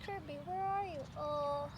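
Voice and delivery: ordinary delivery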